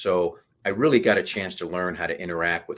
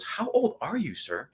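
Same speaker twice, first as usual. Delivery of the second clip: incredulous warrant officer; 11 semitones higher, 3 dB quieter, slower than usual. Retold or imitated. Warrant officer stunned by the colonel's age, high incredulous question. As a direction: question pitched high, then a dropped, soft sir. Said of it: "looks at me and goes".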